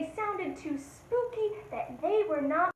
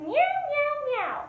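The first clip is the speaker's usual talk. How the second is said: high, loud meow; playful cat meow; 11 semitones higher, 4 dB louder than usual. Shown as a demonstration. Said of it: "The cat in the street goes meow".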